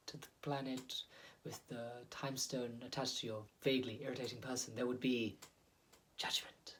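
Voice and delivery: a hint of a grave alien henchman threatening; stony alien villain. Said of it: "he goes like".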